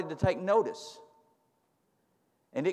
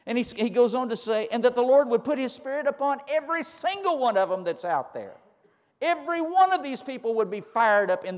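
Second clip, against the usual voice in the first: raised preacher's voice voicing Moses's wish; impassioned preaching; 7 semitones higher, 6 dB louder than usual. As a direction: proclaim it fervently, pitched up and sustained.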